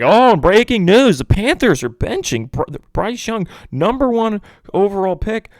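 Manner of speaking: high, loud sports-anchor parody; mock breaking news. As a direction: announce it like a hysterical TV headline. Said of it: "it was all over E S P N".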